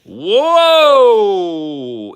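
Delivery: high, drawn-out cry of wonder; amazed whoa. Real, not acted. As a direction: gasp it out, stunned. Sees the prism card.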